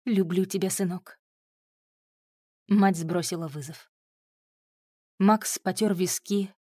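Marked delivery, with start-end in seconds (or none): 0.0-1.2 trembling voice. A narrator's reading. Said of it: "голос матери снова предательски задрожал".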